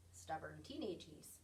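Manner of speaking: usual speaking voice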